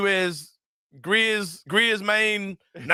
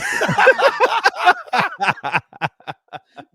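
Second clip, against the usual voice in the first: loud laughter; 12 semitones higher, 5 dB louder than usual. Real, not acted. Laughing at coach's quote.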